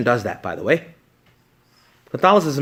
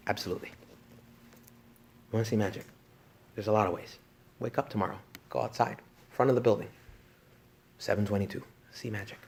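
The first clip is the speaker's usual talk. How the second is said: hushed; 2 semitones lower, 9 dB quieter than usual. Acted. Hushed, soft talk about magic.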